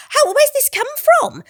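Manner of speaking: high-pitched burst of disbelief; amazed outburst, retelling a first reaction; astonished. Retold or imitated. Amazed reaction on reading script.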